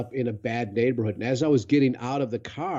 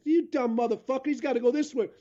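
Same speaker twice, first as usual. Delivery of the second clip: higher mock yell of a man arguing over directions; arguing local guy; 10 semitones higher, faster than usual. Gas station local yelling directions at a lost stranger, mock yell. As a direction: bark it out like a bystander butting in. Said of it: "And he's".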